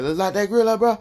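brother-in-law egging everyone to grill; 1 imitating brother-in-law. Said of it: "he used to be like".

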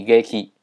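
悲劇 read as higeki; Japanese reading. Shown as a demonstration. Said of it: "悲剧念作".

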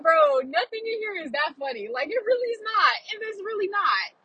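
high-pitched mock exasperation; exasperated protest. Moviegoer to a man laughing at everything, exasperated. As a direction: plead with him that it isn't funny.

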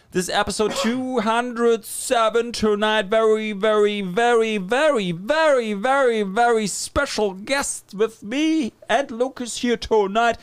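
high, drawn-out game-show intro; show announcer. Acted. Host introducing the special guest to listeners, hyped announcer voice. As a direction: build up to the guest's name, stretching each very.

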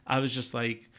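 ordinary talk

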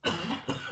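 sputtering cough after swallowing wrong; coughing up water. Real, not acted. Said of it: "water went down the wrong pipe".

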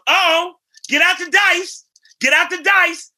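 high, loud and slow, dragging out dice; the star hollering to friends across the room. Retold or imitated. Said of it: "He goes".

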